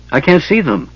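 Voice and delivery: frightened cry to her mother; cry of agony. A narrator's reading. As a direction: call out for help in anguish. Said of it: "she cried in agony".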